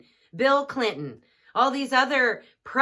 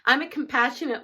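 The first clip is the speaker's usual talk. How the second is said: usual speaking voice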